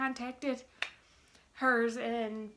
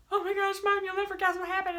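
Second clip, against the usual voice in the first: high, loud re-enacted excitement; giddy retold squeal to Mom; freaking out; 9 semitones higher, 4 dB louder, faster than usual. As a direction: burst out with huge news, pitched way up. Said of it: "freaking out".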